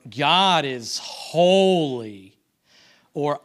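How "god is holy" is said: slow, drawn-out spoken mock praise; louder, heavily stretched words, insincere; sarcastic tone. Shown as a demonstration. Preacher illustrating tone of voice in worship, sarcastic. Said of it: "in this tone of voice".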